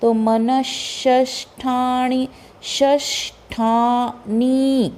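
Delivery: very slow मनश्ष्ठाणि, joined consonants; slow pronunciation. Shown as a demonstration. Teacher showing the joined sound, very slowly. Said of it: "उस पे depend करता है".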